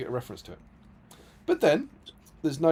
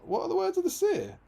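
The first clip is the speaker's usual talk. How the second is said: mock Legolas. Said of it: "And then Legolas says".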